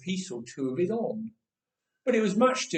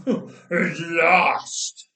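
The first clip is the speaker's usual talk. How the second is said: slow, louder giant's drawl; 7 dB louder than usual. Acted. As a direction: drawl it out like a hungry man at dinner time. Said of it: "Goliath drawled".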